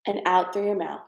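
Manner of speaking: a gentle hint of meditation calm; guided breathing. Leads a breathing exercise.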